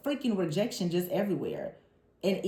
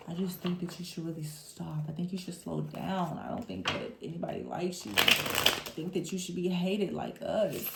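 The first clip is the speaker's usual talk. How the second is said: hushed, softer put-on voice of a discouraging hater; soft sneering hater; 5 dB quieter than usual.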